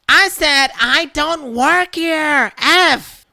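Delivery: high, loud, slow furious repeat; swearing Karen. Retold or imitated. Karen cursing at the shopper, slow and furious. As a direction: hiss out the curse.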